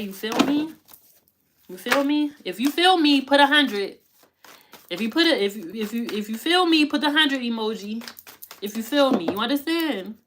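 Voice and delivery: rally the viewers, full of energy; loud hype-man call; hyped call-out. Real, not acted. Hyping the audience up.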